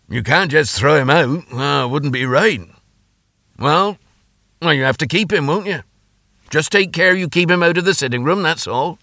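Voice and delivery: high-pitched, grudging consent from dad; father relenting. Acted. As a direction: give in, with a warning attached. Father gives in about kitten.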